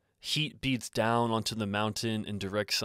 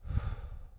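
soft, audible breath out; slow meditative exhale; exhale through mouth; 7 dB quieter than usual. Shown as a demonstration. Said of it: "Exhale through the mouth".

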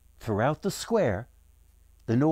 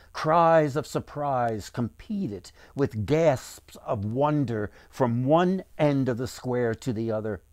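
ordinary delivery, nothing marked

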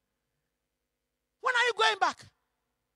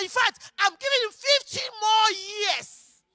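loud, raised, forceful order; shouted command; 2 semitones higher, 6 dB louder, slower than usual. Acted. God sending prophet back to the king, loud command. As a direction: send the messenger back with full-voiced urgency.

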